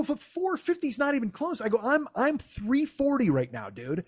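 high-pitched comic exasperation; aggrieved, insistent high voice; indignant protest. Retold or imitated. Acted protest about his weight.

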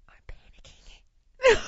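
high, slow, hushed panicky whisper; retold panic. Terrified diner confides in dining partner, panicky whisper. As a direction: squeeze out a mock-panicked whisper. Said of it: "So now I'm in terror".

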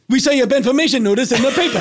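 same flat delivery every take, sped up; identical robotic line reading. Retold or imitated. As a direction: rattle it off exactly the same each time. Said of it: "freakishly the same thing every time".

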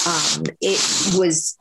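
usual speaking voice

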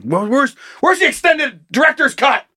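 mock demand. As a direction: whine like a fan cheated of the ending. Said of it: "and I wasn't like".

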